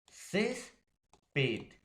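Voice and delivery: "césped" in two pieces, high "cés", falling "ped"; stress on penultimate syllable. Shown as a demonstration. Teacher explaining to students, stressed "cés" in "césped". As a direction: raise "cés", pause, drop on "ped". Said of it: "la mayor fuerza de voz".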